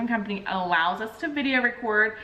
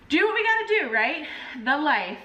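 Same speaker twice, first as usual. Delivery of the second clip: emphatic resolve; 6 semitones higher than usual. Real, not acted. Resigned pep talk.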